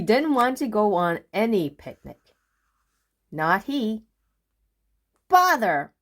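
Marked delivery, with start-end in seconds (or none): 5.3-5.9 higher, louder irritated; annoyed bother. Acted. Mister Busy finds nobody, annoyed. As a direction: spit it out. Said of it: "said Mister Busy again".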